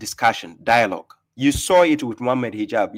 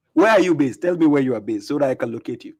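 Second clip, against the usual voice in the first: normal talking voice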